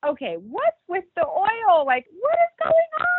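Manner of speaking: very high, slow what's with the oil; baffled. Retold or imitated. Woman pulls out oil, baffled squeak. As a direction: squeak it out confused.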